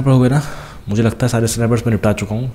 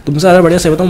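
much higher, louder teasing jibe; a gloating put-down to the thugs; mocking taunt; 8 semitones higher, 5 dB louder, faster than usual. Real, not acted. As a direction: rub it in to the thugs. Taunting beaten enemies.

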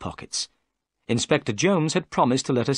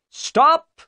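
very high, loud police shout; shouted order; 8 dB louder than usual. A narrator's reading. Jones orders the fleeing boat to stop, shouting high. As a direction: yell across the water to halt them. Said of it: "he cried".

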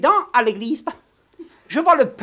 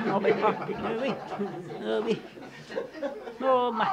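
ordinary talk

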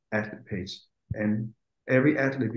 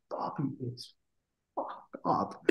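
confidential whisper; hushed gossip to the host; whispered aside; 13 dB quieter than usual. Real, not acted. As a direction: drop to a whisper for the secret. Gossip aside about Bobby.

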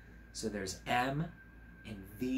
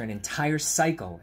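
plain reading voice

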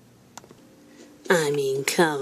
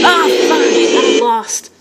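frustrated yell; 2 semitones higher, 6 dB louder, slower than usual. Real, not acted. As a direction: curse out loud at losing. Dies in game.

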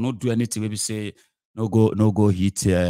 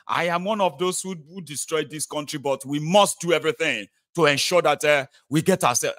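mock rogue politician; 6 semitones higher than usual. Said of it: "They even come before you and say".

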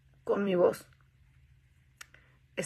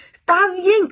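exasperated outburst; 10 semitones higher, 11 dB louder than usual. Retold or imitated. Exasperated quote to God.

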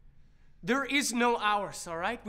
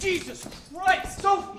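high, raised-voice protest; exasperated outburst; 8 semitones higher, 4 dB louder, slower than usual. Acted. Man reacting to his girlfriend's outburst, exasperated. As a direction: cry out in exasperation.

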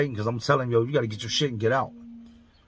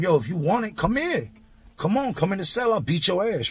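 retold threat; 6 semitones higher than usual. Daring the cellmate to come fight, high taunting threat. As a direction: goad him into the cell.

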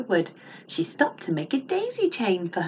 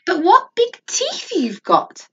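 high slow wonder; 12 semitones higher, 5 dB louder, slower than usual. A narrator's reading. Girl peering at disguised wolf.

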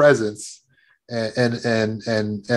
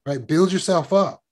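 ordinary talk, nothing marked

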